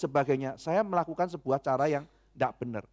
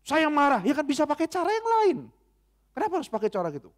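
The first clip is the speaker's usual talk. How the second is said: mock angry outburst; 9 semitones higher than usual. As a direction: play the angry man caught out. Pastor reenacts his angry outburst. (marah yang dibuat-buat, suara tinggi dan keras)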